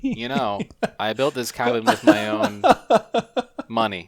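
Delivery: mock Seagal line with a pause before money; deadpan action hero quote; imitating Seagal. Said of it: "he didn't do that pause that makes it funny".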